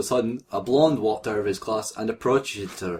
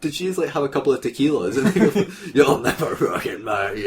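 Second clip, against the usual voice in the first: giggling while speaking; can barely hold the laugh; laughing through it; 3 semitones higher than usual. Real, not acted. Imagining drunk Mystique, laughing through the words.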